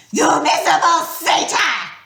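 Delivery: high, loud furious snarl. A narrator's reading. Medusa snarling at Grover in fury. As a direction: spit it at the satyr. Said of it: "she snarled".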